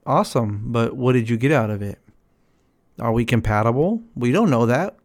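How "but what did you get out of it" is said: usual speaking voice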